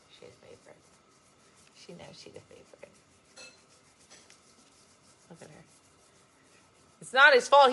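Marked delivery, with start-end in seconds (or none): 0.1-5.6 breathy whisper. Real, not acted. About the favourite pet, a soft whisper. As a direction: whisper it conspiratorially.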